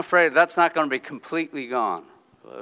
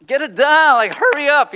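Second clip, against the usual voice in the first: mock urgent, high and loud; urgent call; 14 semitones higher, 11 dB louder than usual. Pastor rushing the team, high loud urgent. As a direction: hurry them along like Pastor Juana. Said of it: "Pastor Juana's like".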